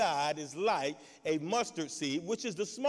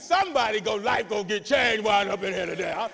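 louder, defiant paraphrase; mock bold sick woman, getting loud; fired-up patient; 8 dB louder than usual. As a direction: pastor hamming up the sick woman's zeal. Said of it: "But she was like".

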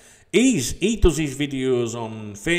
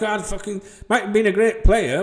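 normal talking voice

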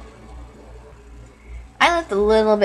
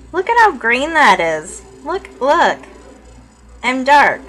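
higher, louder, thrilled; excited; 4 semitones higher, 6 dB louder than usual. Real, not acted. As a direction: show off the dark green. Thrilled at dark face.

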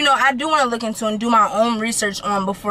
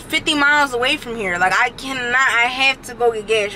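ordinary talk

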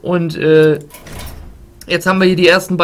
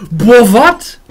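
loud, higher stunned outburst; shocked exclamation; 6 semitones higher, 11 dB louder than usual. Real, not acted. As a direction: blurt it out in disbelief. Stunned by a card effect, repeated louder.

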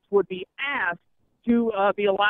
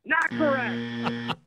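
drawn-out, softer game-show ruling; mock quiz-show host; 2 semitones higher, 5 dB quieter, much slower than usual. Host ruling on contestant's wrong answer, drawn-out mock quiz-show verdict.

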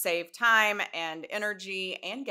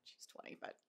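whispered aside; 22 dB quieter than usual. Real, not acted. Confessing the niece's real age mid-ad, whispered aside. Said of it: "whispered aside".

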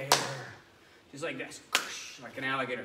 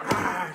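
loud growling roar; lion roar; 4 semitones higher, 11 dB louder than usual. Shown as a demonstration. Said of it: "make that sound like a lion roar".

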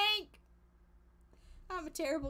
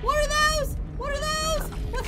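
high, loud frightened shrieking; panicked scream; 9 semitones higher, 12 dB louder than usual. Real, not acted. Panicked at creatures in game.

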